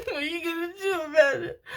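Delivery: daring Spirit to stop her; sassy retort. Retold or imitated. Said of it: "And I was like".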